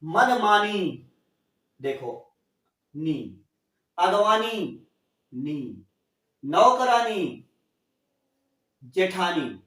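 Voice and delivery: "मनमानी" said slowly, each syllable clear; slow demo word. Shown as a demonstration. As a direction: draw out "मनमानी" for the class. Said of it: "बड़ी ई की मात्रा न पर लगा होना चाहिए". ("मनमानी" धीरे-धीरे, हर अक्षर साफ़)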